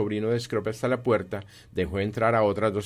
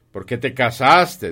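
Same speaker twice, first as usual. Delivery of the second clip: loud, slow, higher-pitched challenge; scornful demand; 4 semitones higher, 10 dB louder, slower than usual. Acted. Scrooge questions his nephew's marriage, loudly scornful. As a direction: hurl the question out slowly and loudly.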